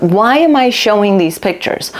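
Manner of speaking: usual speaking voice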